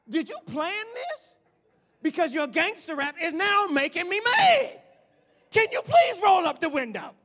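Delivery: exasperated, high, loud rant; hammed-up fed-up yelling at a driver; venting. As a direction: build from accusing question to a full shout. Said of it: "Thank you for letting me vent".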